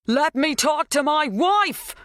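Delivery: loud, high, slow shout. A narrator's reading. Dan demanding to speak to his wife, shouting. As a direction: drag out the demand at full volume.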